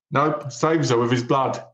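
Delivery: ordinary talk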